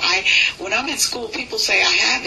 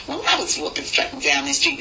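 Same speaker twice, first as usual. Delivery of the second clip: New York routine opener; 3 semitones lower than usual. Shown as a demonstration. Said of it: "the real New York accent".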